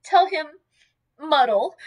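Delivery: high, emphatic tearful plea. A narrator's reading. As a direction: press the message out through distress. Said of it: "the tears poured over her cheeks".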